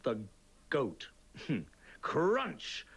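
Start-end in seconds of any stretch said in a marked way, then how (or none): 2.0-2.9 "Crunch!" shot up high with an exclamation; read with exclamation. Shown as a demonstration. Teacher to children, reading the exclamation mark with energy. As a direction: punch "Crunch!" out high and lively like the goat's bite. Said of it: "We have to read it loudly".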